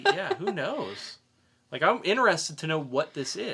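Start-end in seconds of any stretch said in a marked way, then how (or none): 0.0-1.2 laughing. Real, not acted. Joking about the plot.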